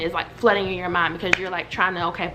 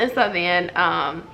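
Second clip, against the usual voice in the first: normal talking voice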